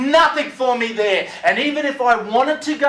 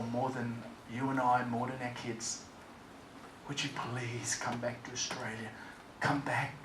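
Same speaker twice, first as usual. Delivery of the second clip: softer, lower, gentle pleading ex-wife; softer, tender plea of the ex-wife; 11 semitones lower, 21 dB quieter than usual. Retold or imitated. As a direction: beg him gently to come home. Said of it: "she said to me".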